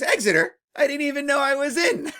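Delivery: loud, mock-baffled joke delivery; joking punchline. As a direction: deliver the punchline loudly, breaking into a laugh. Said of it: "my favorite joke about Exeter".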